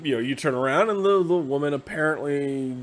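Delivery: usual speaking voice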